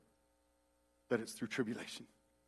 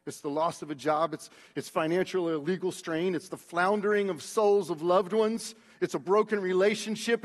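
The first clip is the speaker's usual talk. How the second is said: ordinary talk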